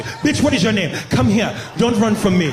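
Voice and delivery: mock shout in an African accent. African man accosting a woman at the club, commanding African accent.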